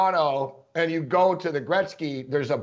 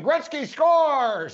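mock announcer call; 8 semitones higher, much slower than usual. Imitating the replayed arena call.

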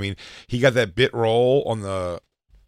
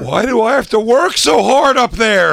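mock whining; 13 semitones higher, 9 dB louder than usual. Mock whining about comedy.

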